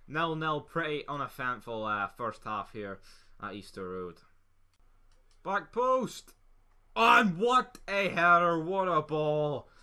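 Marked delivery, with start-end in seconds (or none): 5.4-9.7 high loud drawn-out celebration; long goal yell. Real, not acted. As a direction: roar it as the ball goes in. Scoring a goal.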